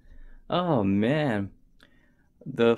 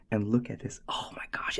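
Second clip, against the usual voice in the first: hushed, breathy awe; awed whisper; 7 dB quieter, faster than usual. Real, not acted. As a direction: whisper it like it's precious. Awed hush over strap.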